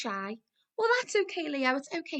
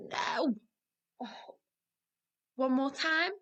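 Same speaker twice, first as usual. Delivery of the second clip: ordinary talk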